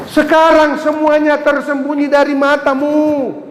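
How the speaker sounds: slow, high, loud solemn pronouncement; solemn divine quote. Acted. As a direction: proclaim it slowly from on high. Quoting God's words solemnly. (firman diucapkan lambat dan khidmat)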